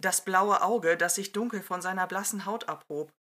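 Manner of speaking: lively read-aloud narration with character voices; story narration. A narrator's reading. Podcast host reading a fan story aloud, lively narration. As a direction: tell the fan story to your listeners.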